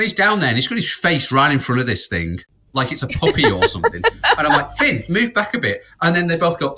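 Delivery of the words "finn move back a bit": sharp high call to son; urgent warning. Retold or imitated. Dad warning son off the snake, high urgent call. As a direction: pull him back from the snake, fast.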